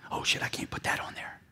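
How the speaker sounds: hushed, embarrassed aside; mock whisper. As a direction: whisper it like hiding a secret. Mocking embarrassed business owners.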